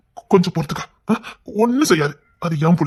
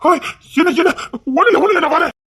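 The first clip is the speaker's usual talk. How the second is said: startled alarm; 9 semitones higher, 6 dB louder than usual. Acted. Husband startled awake at night.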